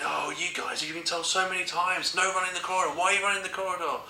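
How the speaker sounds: high, exasperated telling-off; mock scolding headmaster voice; scolding deputy head. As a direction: play the fed-up headmaster scolding two boys. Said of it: "And he's saying".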